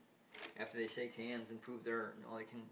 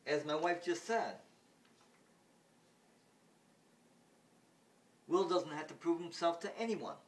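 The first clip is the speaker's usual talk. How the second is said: higher and louder, resolute; firm father; 6 semitones higher, 6 dB louder than usual. Retold or imitated. Father refuses the ruler, high and firm. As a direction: back up the wife firmly. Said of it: "Then John says".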